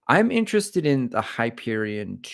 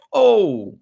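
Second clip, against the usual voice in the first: loud high hooted "oh"; coworker's high "oh"; 9 dB louder than usual. Retold or imitated. Imitating a coworker from Wyoming, high loud "oh". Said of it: "He always goes".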